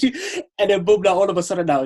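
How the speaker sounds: ordinary talk, nothing marked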